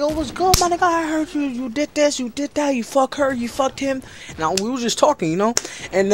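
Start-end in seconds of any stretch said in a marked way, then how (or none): 0.0-4.0 higher, rapid-fire mock chatter; hyped retold greeting. Student greeting his boy with gossip, high mock chatter. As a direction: act out excitedly greeting your boy.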